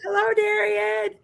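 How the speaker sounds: high sing-song greeting back; playful high call of hello; mock chorus reply. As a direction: answer like a crowd of kids greeting the teacher. Group's mock reply to Darian.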